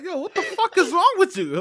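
high, loud, laughing disbelief; incredulous outburst. Retold or imitated. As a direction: act out bafflement, voice leaping way up. Acts out baffled reaction to threat.

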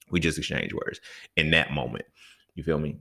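ordinary talk, nothing marked

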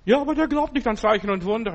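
high-pitched imitation of an objecting caller; mock protesting woman. (hoch nachgeahmter Einwand einer Frau)

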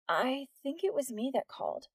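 put-on sleepy, drowsy voice of a teen; groggy voice. A narrator's reading. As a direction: play up the groggy half-asleep sound. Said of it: "letting her groggy voice work in her favor".

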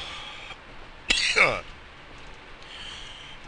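hacking coughing fit; real coughing fit; harsh cough. Real, not acted. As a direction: cough it out hard. Coughing after a hit.

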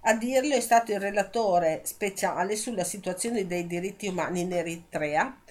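ordinary delivery

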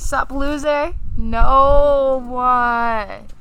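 loud, drawn-out disbelief; mock offended. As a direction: repeat it as if wounded, hammed-up. Mock hurt at being called loser.

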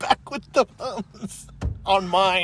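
slow, laughing through the line; words breaking into laughter; cracking up mid-line. Real, not acted. Cracking up after joke.

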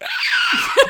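loud, far-off-pitch comic scream; mock scream. Opening the front door into Florida humidity, comic scream. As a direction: scream as if hit by a wall of heat. Acting out stepping into humid heat.

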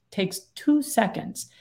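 usual speaking voice

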